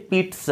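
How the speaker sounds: पिट्स said "peet-suh", with a ts sound; correct pronunciation. Shown as a demonstration. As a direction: put a t before the s in पिट्स. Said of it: "this one is the correct pronunciation".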